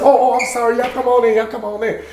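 high-pitched imitation of a hasty welcome; flustered apology. Wife caught out welcomes guests at door, flustered apology.

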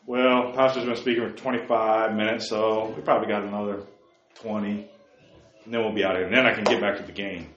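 put-on low, soft, lazy mumble of a bored teen; a hint of an uninterested kid waiting to go home to his games; bored churchgoer. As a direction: sound like you're counting minutes till church ends.

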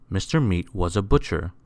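ordinary delivery

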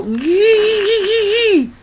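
husband imitating his wife's tingly squeal; imitating wife's giggle. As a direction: mimic her tickled little squeal. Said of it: "and she'd go".

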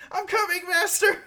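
high, loud servile cry; devoted servant. Acted. Priest rushing to heal his master, high loud call.

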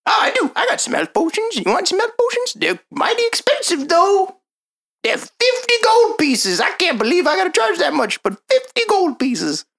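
high, put-on gnome shopkeeper; squeaky-high gnome merchant. GM as shopkeeper selling potions.

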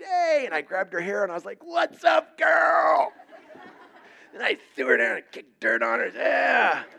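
high, loud, hammed-up yell; joking about throwing her down, shouting; mock tough shout. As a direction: bellow it like a playground roughhouser. Mock wrestling retold, shouting.

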